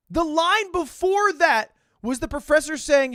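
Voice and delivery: ordinary talk, nothing marked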